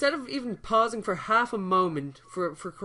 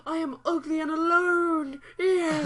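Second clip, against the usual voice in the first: high, loud whiny sob voice; imitating Mantis crying out; mock Mantis cry; 11 semitones higher, 6 dB louder, slower than usual. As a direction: wail it like a sad naive alien. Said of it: "And Mantis is like".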